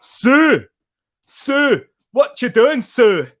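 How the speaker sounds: hammed-up Scottish call to Sue; Scottish accent. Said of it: "It's like saying Sue in a Scottish accent".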